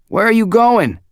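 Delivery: high, loud call after someone. A narrator's reading. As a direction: shout down the hall. Zeke calling after him.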